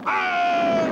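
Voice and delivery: high shout of surprise; startled shout. Acted. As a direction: yelp as you're yanked upward. Yanked up on the rope. (erschrockener Schrei)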